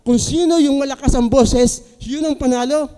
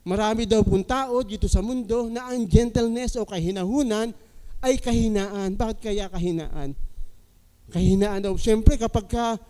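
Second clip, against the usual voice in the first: usual speaking voice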